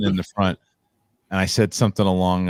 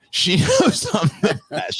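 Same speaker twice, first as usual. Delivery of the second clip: slow, higher, half-laughing mock shout; reenacting the original line; 11 semitones higher, slower than usual. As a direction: relive the cocky stage line with a laugh. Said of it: "And I remember going".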